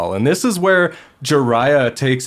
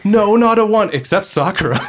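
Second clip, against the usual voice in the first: put-on excited kid voice; 10 semitones higher, 4 dB louder than usual. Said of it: "Naruto's like".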